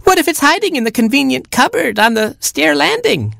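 put-on very high, panicky baby chick; piercing little squeal; screeching chick. A narrator's reading. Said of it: "screeched Baby Chick".

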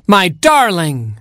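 high, slow, rapturous cry; joyful cry. Acted. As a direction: cry out in delight. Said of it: "cried the prince".

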